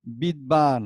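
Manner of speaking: বিদ্বান as bid-baan; spelling pronunciation. Shown as a demonstration. Teacher introduces the written word, বিদ্বান with audible b. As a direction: sound the ব in বিদ্বান. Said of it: "আবার এখানে লিখছি".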